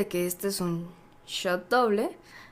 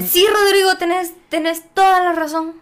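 exaggerated agreement; 8 semitones higher, 8 dB louder than usual. Real, not acted. Mock agreement, loud.

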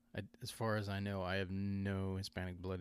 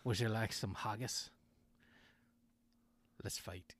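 put-on Scottish accent. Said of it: "his regular accent".

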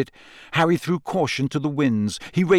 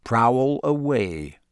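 slow, menacing invitation; full of menace; much slower than usual. A narrator's reading. Moody daring Snape to prowl, full of menace. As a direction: drag it out as a threat. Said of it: "his voice was full of menace".

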